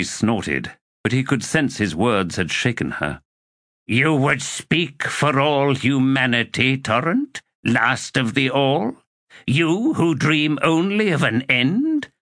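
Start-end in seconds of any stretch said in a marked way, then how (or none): 3.9-12.1 higher, slow scornful witch voice; old sorceress scorning Torrent, higher and slow; mocking old sorceress. A narrator's reading. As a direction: sneer at his idealism slowly. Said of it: "Olar Ethil mocks Torrent".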